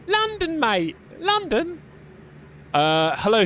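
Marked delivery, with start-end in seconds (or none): 0.1-1.8 high, squeaky put-on London twang; hammed-up London accent. Streamer tells viewer he's from London, hammed-up high London accent. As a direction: ham up the London accent, pitched way up.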